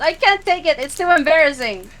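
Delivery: high, fast embarrassed rant; cringing player. Acted. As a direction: whine from secondhand embarrassment. Player cringing against the wall.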